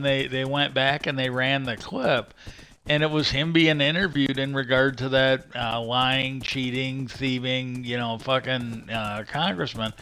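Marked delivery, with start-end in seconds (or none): none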